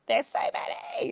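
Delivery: high, drawn-out squeak; thin, high comic wail; mock despair. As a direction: play up being overwhelmed, high and slow. Mock despair at many challenges.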